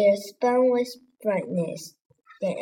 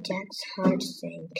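ordinary delivery, nothing marked

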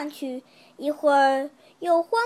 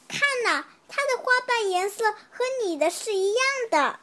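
high, excited little ant calling out; a child's excited little-ant cry; 6 semitones higher than usual. Acted. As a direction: point it out with a child's thrill. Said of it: "小蚂蚁大叫".

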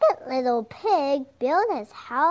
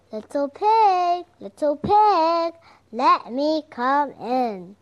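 loud, high wolf calling at the door; 3 semitones higher, 8 dB louder than usual. Acted. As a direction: bang on the door as the big bad wolf. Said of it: "said the wolf".

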